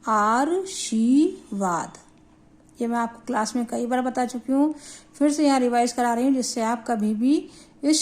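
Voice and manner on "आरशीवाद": आरशीवाद in slow, separate chunks, the r moved before shi: aar-shee-vaad; misspelling sounded out. Shown as a demonstration. Teacher shows pupils what their misspelling says, slow wrong pronunciation aar-shee-vaad. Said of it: "ये शब्द क्या हुआ".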